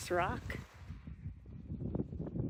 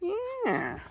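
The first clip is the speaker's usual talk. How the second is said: cooing. Real, not acted. Cooing over a tiny seedling.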